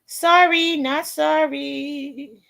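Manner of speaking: high, slow, drawn-out sass; sassy. Real, not acted. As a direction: stretch out not sorry cheekily. Sassy dismissal of critics.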